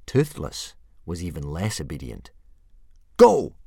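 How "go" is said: high, loud yell; yelled command. A narrator's reading. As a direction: bark it out at full volume. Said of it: "yelled Hiccup".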